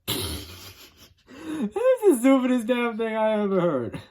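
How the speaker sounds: high, loud disbelief through laughter; laughing scoff. Retold or imitated. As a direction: crack up at how dumb it is. Cliff scoffing, retold laughing.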